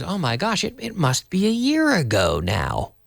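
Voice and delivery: acted high-pitched surprise; acted realisation. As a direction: exclaim it as if suddenly realising. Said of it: "So this logical goes".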